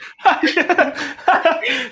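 high, bright cackle of a laugh; burst of laughter. Real, not acted. Laughing at the blonde remark.

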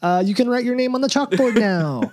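high, loud, gushing pretend praise; mock teacher praise. Host playing teacher to a pupil, mock gushing praise.

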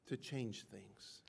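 ordinary delivery

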